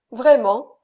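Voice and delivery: loud, bitter one-word exclamation; bitter scorn. A narrator's reading. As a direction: throw it out with bitter mockery. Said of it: "avec un rire amer et éclatant". (exclamation amère et éclatante)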